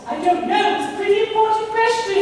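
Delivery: high, quick coy lady voice; mock lady's voice. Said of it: "imagine you are Miss Sarah Hampton".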